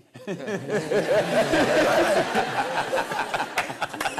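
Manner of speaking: loud burst of laughter, falling away; big laugh. Real, not acted. Young man laughing at being named among elders, loud laugh.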